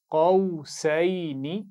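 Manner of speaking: drawn-out, melodic tajweed recitation; slow Quran recitation. Shown as a demonstration. Teacher reciting slowly for pupils to repeat. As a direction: chant each syllable slowly for the class to copy. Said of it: "आप गौर से सुनेंगे और फिर दोहराएंगे".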